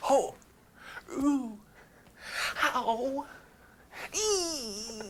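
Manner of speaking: high hammy death cries; dying cries. Shown as a demonstration. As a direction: groan like being stabbed slowly. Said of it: "a really slow dying scene when they stab me".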